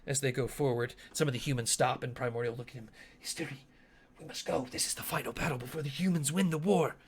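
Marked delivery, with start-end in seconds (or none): 3.2-7.0 hushed, urgent plea; urgent soldier in character. Acted. Human soldier urges Asteri on to battle, hushed and urgent. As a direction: press your leader quietly, picking up pace.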